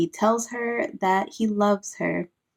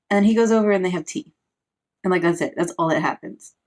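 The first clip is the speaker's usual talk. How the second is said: usual speaking voice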